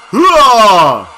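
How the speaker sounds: loud, drawn-out falling yell; one long shouted exclamation; loud reaction shout. Real, not acted. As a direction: let out a big "hua" that slides down.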